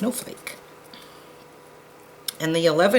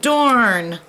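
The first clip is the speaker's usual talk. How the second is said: frustrated exclamation; 6 semitones higher, 6 dB louder than usual. Real, not acted. Disappointed at missing color.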